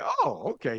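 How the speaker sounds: soft, squeaky high "oh, okay"; a startled little falsetto; high surprised oh okay. Retold or imitated. Recalling seeing his future wife, hushed high squeak. Reacting to her on treadmill.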